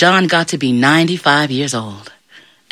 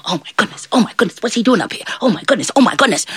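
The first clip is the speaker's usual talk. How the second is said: high, fretful little voices; flustered little angels; 7 semitones higher, faster than usual. Acted. Little angels peeking out at John, flustered. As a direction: chatter anxiously like tiny onlookers.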